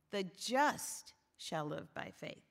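slow, with just held and punched; stress on just. Shown as a demonstration. Preacher quoting Galatians, stress on just. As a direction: draw out just, then the rest follows evenly. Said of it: "with the emphasis on the just".

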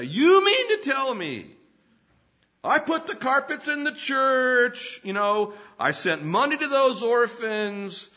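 wounded pride swelling into an indignant yell; indignant churchgoer. Acted. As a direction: play the affronted churchgoer who can't believe it, rising to a shout. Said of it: "you know how they get all angry".

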